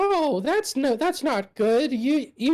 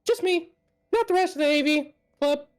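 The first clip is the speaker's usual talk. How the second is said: nervous nerd voice; 8 semitones higher, faster than usual. Acted. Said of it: "he's like".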